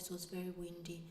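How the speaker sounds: ordinary talk, nothing marked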